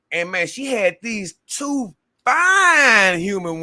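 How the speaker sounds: loud, high, drawn-out rave about the women; excited. Retold or imitated. Recalling the dream women, loud and slow. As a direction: shout it out, slow and amazed.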